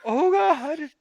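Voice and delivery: very high mock wail; retold horror in a squeal; horrified. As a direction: wail it in comic dismay. Acted horror at paralysed squirrel.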